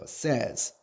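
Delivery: "says" with a short e, like "sez"; "says" rhyming with "fez"; pronunciation of says. Shown as a demonstration. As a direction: model "says" as one syllable, "sez". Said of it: "발음해야 돼요".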